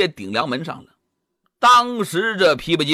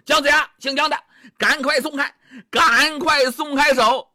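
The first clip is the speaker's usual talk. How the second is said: higher, angry shout of the mob; crowd shouting; 5 semitones higher than usual. Acted. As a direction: yell at him to let go. Angry crowd yells at Jiang.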